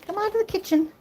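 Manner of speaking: high, sing-song cat talk; pet-coaxing voice. Real, not acted. Asking Murph to come play, high coaxing voice.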